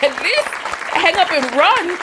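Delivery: indignant. Real, not acted. Scoffing at caller who stayed on.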